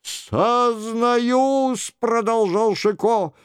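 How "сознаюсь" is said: high, mock-pitiful penitent; whimpering act, pitched up; plaintive voice. A narrator's reading. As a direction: play the sorry king. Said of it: "жалобным голосом".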